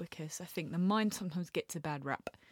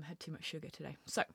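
normal talking voice

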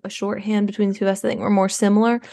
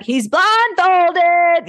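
drawn out, much higher and louder; exasperated yell; 9 semitones higher, 5 dB louder, much slower than usual. Retold or imitated. Mother yelling at maid who let blindfolded son trip. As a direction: stretch it out in alarm.

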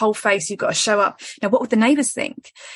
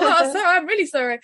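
panicked apology; 8 semitones higher than usual. Retold or imitated. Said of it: "that threat of".

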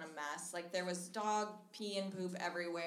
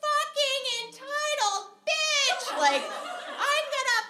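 high, loud screaming rant; high enraged yell at the roommate; furious yelling; 9 dB louder, slower than usual. Retold or imitated. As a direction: scream it at her, out of control. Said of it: "freaked out at me".

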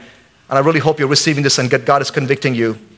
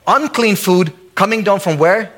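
ordinary delivery

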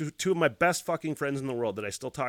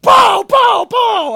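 screamed, high-pitched pows; full-force shouted impact noises; imitated banging; 17 dB louder, much slower than usual. Imitating hitting the air conditioner.

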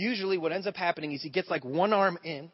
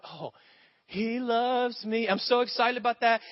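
high, very slow gushing delight; mock starry-eyed joy; excited new believer; 5 semitones higher, slower than usual. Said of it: "They come to Jesus".

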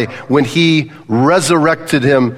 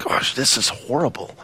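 hushed dismay; 3 semitones lower, 9 dB quieter than usual. Acted. Voicing Jesus dismayed at death.

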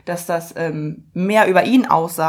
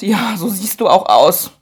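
mocking stranger; 8 dB louder, slower than usual. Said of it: "mustert er mich und sagt".

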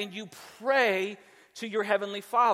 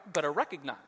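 low stern parent warning; 6 semitones lower, 5 dB quieter, much faster than usual. Retold or imitated. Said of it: "I'll say things like that".

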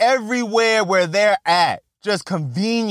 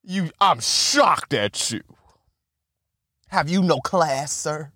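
mock posh disdain; 5 semitones lower, slower than usual. Mock posh disapproval of Aaron Paul.

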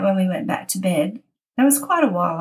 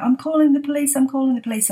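higher, panicky mock of a scared teen; freaked-out daughter; 6 semitones higher, faster than usual. Scared daughter insisting to her mum she'll call police, pitched-up panic.

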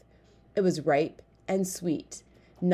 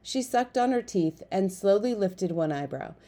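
usual speaking voice

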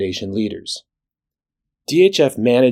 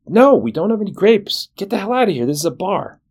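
angry bartender; 10 semitones higher than usual. Acted. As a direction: throw the duck out, exasperated. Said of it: "Bartender looks down at the duck and says".

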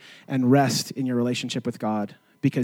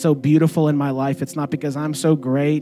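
soft, unhurried reflective voice over music; quiet communion meditation; 4 dB quieter than usual. Acted. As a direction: gently lead the church into gratitude.

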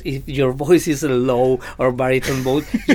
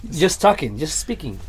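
ordinary delivery

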